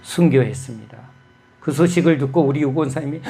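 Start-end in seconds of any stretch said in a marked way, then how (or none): none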